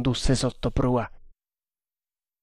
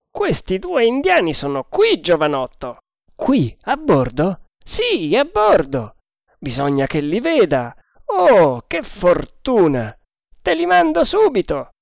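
put-on higher, louder old sea dog; old sailor voice; 6 semitones higher, 3 dB louder than usual. A narrator's reading. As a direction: voice the grizzled boatswain. Boatswain voiced by narrator.

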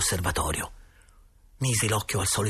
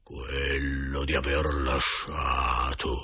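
low, very slow, droning spirit voice; wrathful specter, intoned slowly and low; indignant ghost; 5 semitones lower, much slower than usual. A narrator's reading. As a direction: moan the accusation with stretched vowels. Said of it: "voce un po' più alta e con accento adirato".

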